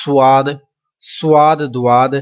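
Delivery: سواد and دواد said the common wrong way; quick wrong reading of سواد; wrong pronunciation demo. Shown as a demonstration. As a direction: show the mistake: سواد, دواد. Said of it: "یہ دونوں طریقے غلط ہیں".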